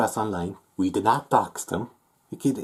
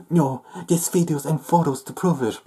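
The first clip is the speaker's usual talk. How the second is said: higher mock-insistent quote; mocking accuser; 7 semitones higher, 4 dB louder than usual. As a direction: imitate them insisting, pitched up.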